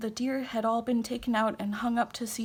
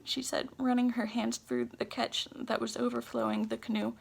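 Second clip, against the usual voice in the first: plain reading voice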